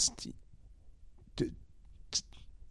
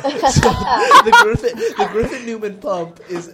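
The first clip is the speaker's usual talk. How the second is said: laughing through the words, voice jumping much higher; 8 semitones higher, 8 dB louder than usual. Real, not acted. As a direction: crack up while announcing the flop. Laughing over the poor download count.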